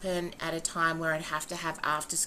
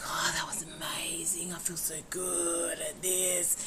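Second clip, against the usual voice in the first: softer, breathy dreamy whisper; 8 dB quieter than usual. Retold or imitated. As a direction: whisper it as if just waking. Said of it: "they're like".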